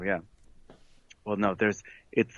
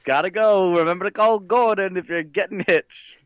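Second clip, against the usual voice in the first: lightly voiced, higher-pitched huckster planner; wedding planner character; 6 semitones higher, 3 dB louder than usual. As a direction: sign off with a raised-pitch sales plug.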